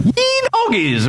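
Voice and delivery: high whooping yell of triumph; mock battle shout. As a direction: holler it like a cowboy cheering. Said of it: "the loud shout".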